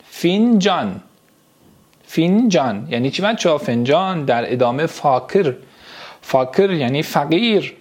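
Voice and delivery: ordinary talk, nothing marked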